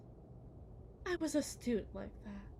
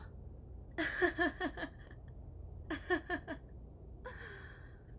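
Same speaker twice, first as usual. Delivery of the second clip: breathy mocking laugh; 4 semitones higher than usual. Wife laughs at husband.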